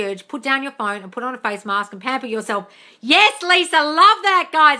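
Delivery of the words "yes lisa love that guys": loud, high cheer; gushing praise. Real, not acted. Coach cheering a viewer's tip, loud and high. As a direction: celebrate it, voice way up.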